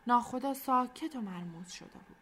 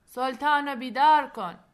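loud, raised call from above; captain's shouted order; 5 semitones higher, 12 dB louder than usual. A narrator's reading. (فریاد آمرانهٔ ناخدا)

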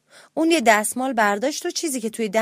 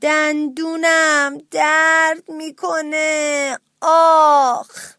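loud, high, long-drawn wail; exasperated pained shout; 5 semitones higher, 7 dB louder, much slower than usual. A narrator's reading. Fed-up mouse answers the woodpecker's questions, shouting in pain. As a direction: yell it out, stretching every word in pain. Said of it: "داد زد".

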